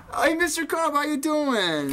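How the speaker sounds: overeager greeting. Acted.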